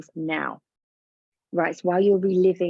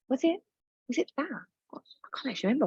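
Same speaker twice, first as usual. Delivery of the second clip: puzzled, forgetting; 7 semitones higher, 6 dB quieter, much faster than usual. Pretend. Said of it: "You might be like".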